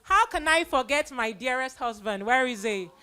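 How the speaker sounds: ordinary delivery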